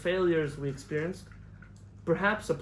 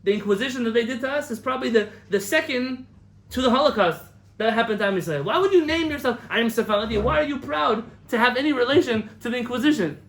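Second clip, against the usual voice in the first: raised, louder voice of an outraged rabbi; retold indignant rebuke; 6 semitones higher, 6 dB louder than usual. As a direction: scold like a rabbi hammering his point home. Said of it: "He said".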